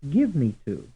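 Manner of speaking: "give" stressed in "give me to"; stress on first word. Shown as a demonstration. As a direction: hit "give", let "me to" fall away. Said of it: "those that are stressed like".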